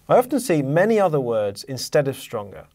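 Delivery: ordinary talk, nothing marked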